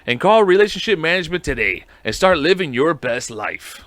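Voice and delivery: cheesy pitchman selling a breakup service; hammed-up infomercial voice, upbeat and pushy; mock ad read. Host reading a fake sponsor ad to listeners, salesman voice.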